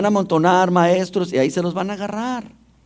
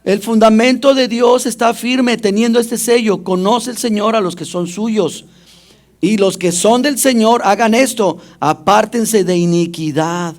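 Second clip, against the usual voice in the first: loud, high preacher's proclamation; forceful preaching; 5 semitones higher, 4 dB louder than usual. Acted. The pastor thunders scripture at his congregation, forceful and loud. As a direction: proclaim it to the whole church.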